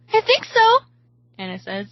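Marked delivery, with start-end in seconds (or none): 0.1-0.7 high, loud, hopeful answer; excited reply. A narrator's reading. Friend answers the excited question.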